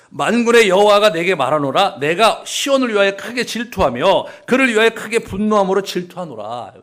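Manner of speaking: loud, high declaiming scripture; solemn proclamation. Acted. Preacher reading God's jealous declaration, loud and solemn. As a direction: proclaim like an oracle. Reads God's oracle with force.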